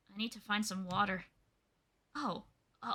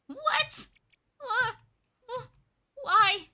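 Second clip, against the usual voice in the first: much higher, rising disbelief; voicing Squirtle's freak-out; shocked shriek; 11 semitones higher, 4 dB louder, much slower than usual. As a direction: stammer in high-pitched alarm. Discovering he is a Pokemon.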